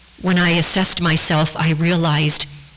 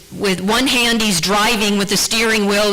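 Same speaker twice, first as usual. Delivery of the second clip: ordinary talk, nothing marked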